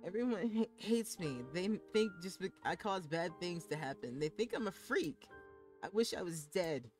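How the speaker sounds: tearful. Acted. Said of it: "tears finally began to fall".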